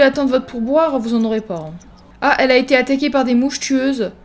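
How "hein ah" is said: usual speaking voice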